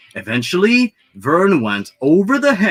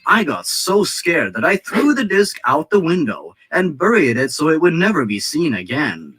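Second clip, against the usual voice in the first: ordinary delivery, nothing marked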